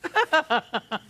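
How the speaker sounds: short laugh. Real, not acted. Judge laughs at zero collected.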